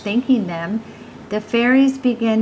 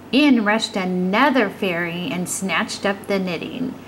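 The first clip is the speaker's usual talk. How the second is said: ordinary delivery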